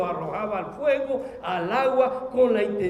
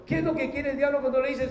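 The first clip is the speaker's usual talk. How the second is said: ordinary talk, nothing marked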